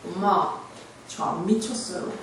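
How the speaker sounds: low, soft and slow, coldly; cold glare. Retold or imitated. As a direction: say it low while glaring at mom. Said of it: "딱 째려보고".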